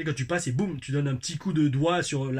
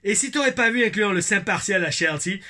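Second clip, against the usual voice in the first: put-on Québécois accent; 3 semitones higher than usual. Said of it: "mon accent québécois".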